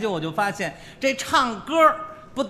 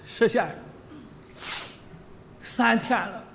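mock tearful; 4 semitones higher than usual. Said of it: "拉着我的手直掉眼泪".

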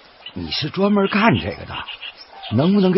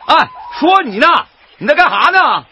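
loud, higher suspicious challenge; calling out; 10 semitones higher, 3 dB louder than usual. Acted. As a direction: shout across to the stranger with suspicion. Challenging a stranger loudly. (船工冲着拍照的人大声喝问)

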